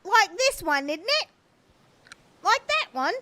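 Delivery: high, loud mock Dickensian British; hammed-up chimney sweep British. Streamer answering chat's accent tease, hammed-up British. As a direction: put on a bad Victorian British sweep accent. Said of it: "Your Aussie accent started so good".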